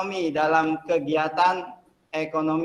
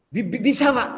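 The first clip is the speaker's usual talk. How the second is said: stammering, syllables repeated haltingly; stuttering job seeker; 8 semitones higher than usual. Acted. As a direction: stutter through every word like a nervous applicant. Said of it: "tahu ya orang gagap ya?".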